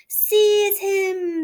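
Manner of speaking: loud, high alarmed shout; cry out. A narrator's reading. Passengers crying out to seize Toad. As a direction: yell to grab him. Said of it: "they cried".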